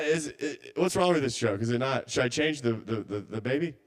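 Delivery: ordinary delivery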